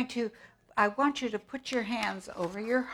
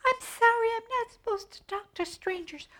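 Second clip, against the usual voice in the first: put-on much higher, sweet child voice; little girl puppet voice. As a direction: answer politely as a small girl. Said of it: "Little Red answers wolf".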